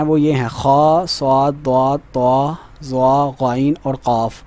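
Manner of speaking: slow, drawn-out صاد، ضاد، طا، ظا; heavy letters drawn out. Shown as a demonstration. Teacher slowly names the seven heavy letters. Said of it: "ہر حالت میں موٹے پڑھے جاتے ہیں".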